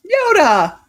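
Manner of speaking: high, loud triumphant call; excited call. Real, not acted. Cheering the viewer's right answer, loud and excited. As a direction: announce the winner with glee.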